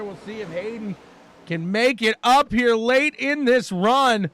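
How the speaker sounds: excited race call with big stretched exclamations; live play-by-play. Real, not acted. Commentator seeing a lead change, excited play-by-play. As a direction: jump on the big moment, then keep the action moving. Calls an overtaking move.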